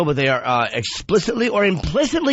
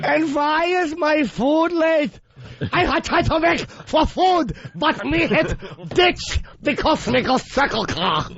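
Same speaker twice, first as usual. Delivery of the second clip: high, slow, broken-English put-on accent; exaggerated foreign accent, slowly; 9 semitones higher, slower than usual. Mocking ethnic speech after accent rule.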